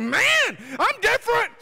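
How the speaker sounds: high, loud burst of amazement; amazed exclamation. Retold or imitated. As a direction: shout it like a stunning discovery. Acts out his amazed realization.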